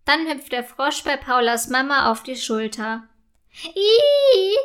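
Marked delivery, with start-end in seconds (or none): 3.6-4.6 loud, high-pitched squeal of disgust. A narrator's reading. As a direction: squeal as if a frog landed on you. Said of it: "quietscht diese". (lautes, hohes Quietschen)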